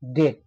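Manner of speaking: "ডেট" with no b before the t; silent B. Shown as a demonstration. Teacher summing up the BT rule, "ডেট" with B silent. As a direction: say "ডেট", vowel straight into t. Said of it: "বির উচ্চারণ silent থেকে গেল".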